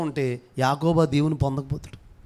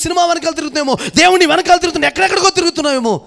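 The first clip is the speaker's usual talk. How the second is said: fast, rising, much louder rebuke; fiery preaching; 9 semitones higher, 7 dB louder, faster than usual. Acted. Pastor rebuking people chasing phones and films, fiery preaching. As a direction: hammer each line harder, racing to a climax.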